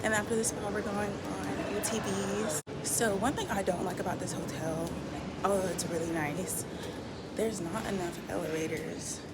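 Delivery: hushed morning vlog; whispering. Real, not acted. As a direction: keep it whispered. Continues whispering.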